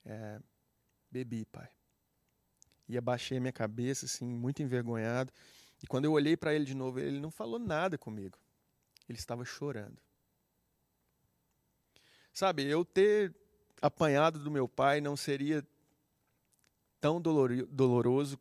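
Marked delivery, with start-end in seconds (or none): 0.0-1.7 much softer, head-down admission; ashamed. Retold or imitated. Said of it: "com muita vergonha daquilo". 12.3-18.4 preaching. Acted. Preacher swells on the emotional point.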